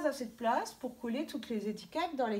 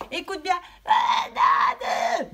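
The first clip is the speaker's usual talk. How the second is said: slow, loud, high mock scolding; 8 semitones higher, 6 dB louder, much slower than usual. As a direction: pretend to snap at the child, hammed up. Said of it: "on lui dit pas". (gronderie pour rire, lente et forte)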